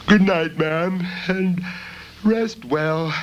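high, drowsy goodnight; sleepy girl. A narrator's reading. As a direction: feign sleepiness. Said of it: "Bella said sleepily".

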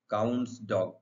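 ordinary delivery

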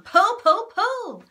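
very loud repeated yell; like a demon driving everyone. A narrator's reading. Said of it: "yelled Muggle One".

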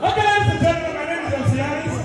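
high announcer call to the crowd; MC hype intro. Acted. MC greeting the audience, high hype call. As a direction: open the show like a hype man.

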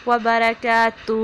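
وبرکاتہ slow with heavy ra; correct recitation. Shown as a demonstration. Teacher demonstrating وبرکاتہ=49. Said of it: "وقف کریں گے تو ہا کی ساؤنڈ آئے گی".

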